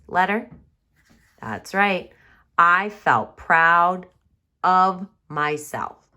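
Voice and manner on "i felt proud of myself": slow model reading. Shown as a demonstration. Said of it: "slow modelled reading".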